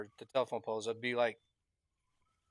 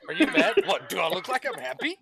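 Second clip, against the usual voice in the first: higher, louder, laughing comeback; mock indignant retort; 11 semitones higher, 7 dB louder than usual. Mocking the question with a laughing indignant retort. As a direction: fire it back half laughing. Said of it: "that would make me mad".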